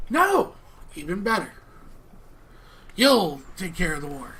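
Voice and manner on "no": loud, high burst of excitement; excited no. Acted. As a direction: blurt it out, thrilled. NPC delighted objection.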